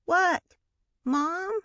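put-on high, piping little boy voice; small child's trill. A narrator's reading. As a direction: pipe it up like a curious toddler. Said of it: "Jim's clear little trill".

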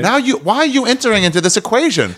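high-pitched indignant questioning; hammed-up aggrieved complaint; exasperated. Acted complaint to unwanted helper.

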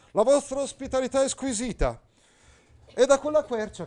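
high, loud pompous knight; Agilulfo pompous. Acted. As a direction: declaim like a stiff courtly knight. Agilulfo courtly declaiming. (Agilulfo, cavaliere pomposo, acuto e forte)

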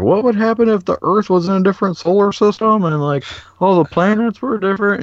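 put-on high, excited kid asking; squeaky childlike wonder; little kid voice. Kid asking the hosts a question in high voice.

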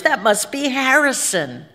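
startled realization. A narrator's reading. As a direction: blurt it out as it dawns on you. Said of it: "said George".

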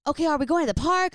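high, loud, quick shout across the distance; retold scream to a brother. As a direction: yell the question up the hill. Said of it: "I scream".

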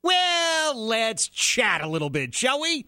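mock grand host voice; mock Trump. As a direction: play the showman inviting the press to chat. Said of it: "it's like".

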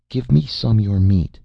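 wheedling, whining plea for food. Acted. A slave girl whines at Jason for some meat. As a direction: whine for a bite of his meat. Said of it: "the slave asked in a whining voice".

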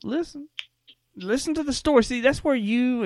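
high, urgent hold-on; insistent. Real, not acted. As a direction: cut him off, make him listen. Interrupting co-host to insist.

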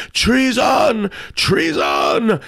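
high, repeated shriek; shrieked accusation. A narrator's reading. Queen joins the accusation against Tarpaulin, shrieking. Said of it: "shrieked Her Majesty of the Mouth".

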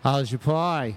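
breezy Aussie greeting with a lifted pitch; Australian accent. Acted. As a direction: ask it like a laid-back Aussie mate. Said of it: "I love a guest who's great with accents".

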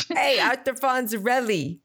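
hammed-up tough-guy greeting; Scott Baio. Riffing on a sitcom catchphrase, mock impression. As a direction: stretch the catchphrase in a put-on accent. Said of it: "anytime I do a Scott Baio impression".

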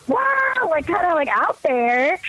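high-pitched exuberant "wow"; outgoing. Retold or imitated. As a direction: burst out with a big wow. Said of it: "you know, outgoing".

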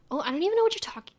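high, fast, lilting line up to "talking"; questioning lilt. Shown as a demonstration. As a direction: imitate an old accent, high and quick, lifting toward "talking". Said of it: "almost making it a question".

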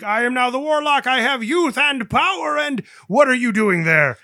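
loud, high gloating villain voice; gloating new warlock. Retold or imitated. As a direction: crow about your new power. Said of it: "who's all like".

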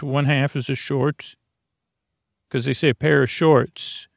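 usual speaking voice

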